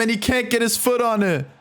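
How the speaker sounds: ordinary delivery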